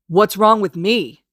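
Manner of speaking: slow, loud barked retort. Acted. Kacchan throwing Izuku's question back, barking slowly. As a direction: bark it back at him. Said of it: "Kacchan barks".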